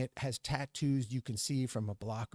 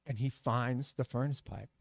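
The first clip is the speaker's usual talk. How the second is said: plain reading voice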